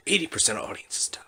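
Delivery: whispered trailer tagline; deadly serious announcer whisper; movie trailer voice. Acted. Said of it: "it's like a commercial".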